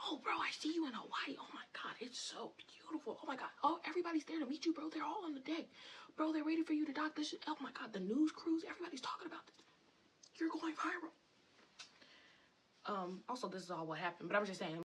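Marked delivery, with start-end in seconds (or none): 0.1-3.5 hushed, awestruck whisper; a gasping, secretive gush of awe; awed hype. Retold or imitated. Hyping friend's vision in awe. 3.6-11.1 high, loud, fast hype; over-the-top excited bro; hyping up. Retold or imitated. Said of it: "I'm literally hyping your shit up".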